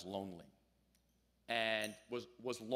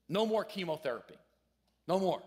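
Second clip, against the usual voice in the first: loud, high, adamant no more; firm refusal; 7 semitones higher, 8 dB louder than usual. Retold or imitated. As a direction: put your foot down, raising the voice. Old father refuses more chemo.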